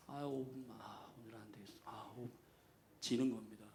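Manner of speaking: sleepy groaning reluctance. Pretend. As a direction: sound like you can't get up. Mocking sleepy reluctant person. (이불 속에서 일어나기 싫은 졸린 중얼거림)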